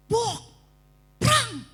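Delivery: loud imitated bang and crash; crash noise. Imitating the crash to the congregation, loud bang. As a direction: shout the crash sounds out. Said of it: "tiba-tiba ada suara".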